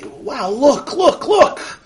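put-on high-pitched, amazed child's squeal; excited little kid. Little kid walking to shul spots something, high and excited. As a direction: pipe up like a thrilled kid. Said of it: "he gets excited".

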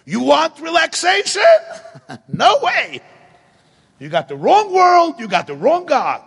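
very high, loud taunting shout with a cackle; God mocking Yaakov's wish for rest, shouting high. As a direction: bellow it down from heaven, laughing at him. God's taunting reply, acted.

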